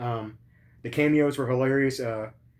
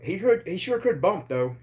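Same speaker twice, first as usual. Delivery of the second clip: higher, grudging mock praise; imitating a wrestler; 7 semitones higher than usual. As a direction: concede it in a lightly put-on higher voice. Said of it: "He's like".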